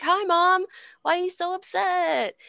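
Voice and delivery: high, put-on voice of clueless boys; childish sons' voice. Sons arriving to find Mom upset, high mock-innocent voice. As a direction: pipe up like mom's little darlings.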